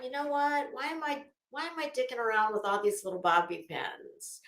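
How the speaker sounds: usual speaking voice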